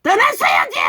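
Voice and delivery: very high, straining shout; exasperated shout. Real, not acted. Preacher saying they should have left, exasperated shout. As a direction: cry out the rebuke at full pitch.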